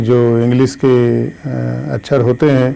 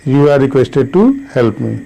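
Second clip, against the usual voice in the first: slow example; much slower than usual. Shown as a demonstration. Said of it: "you are requested to का use करना है".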